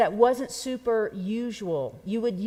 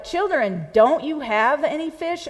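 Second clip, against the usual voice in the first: raised, chiding question that leans on "don't"; teasing; 7 semitones higher, 5 dB louder than usual. Shown as a demonstration. Preacher showing Robin's translation, mild teasing question. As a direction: tease them as you ask why they have no fish. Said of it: "in Robin's translation ... more like".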